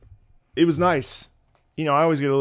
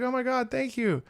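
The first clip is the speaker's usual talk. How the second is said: high, softened grateful voice; imitated thanks; 12 semitones higher, 3 dB quieter than usual. Streamer thanks a fan, high soft gratitude. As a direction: thank them in a high, polite voice.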